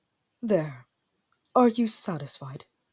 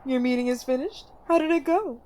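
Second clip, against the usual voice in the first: a gently high, doting queen mother; fussing mother; 7 semitones higher than usual. Acted. Mother greeting her son after his meeting, doting. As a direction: greet your son sweetly and a little eager.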